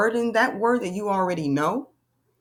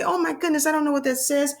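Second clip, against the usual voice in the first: high, flustered "oh my goodness"; mock panic; 7 semitones higher, faster than usual. Shown as a demonstration. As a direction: play the lost reader. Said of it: "you wouldn't say".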